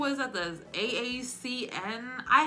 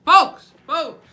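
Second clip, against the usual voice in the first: loud, higher folksy shouting; Joe Biden; 5 semitones higher, 11 dB louder than usual. Acted. Mimicking a politician talking of Scranton to the people, shouted. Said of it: "There's always, like, a shout".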